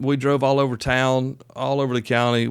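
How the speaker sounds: normal talking voice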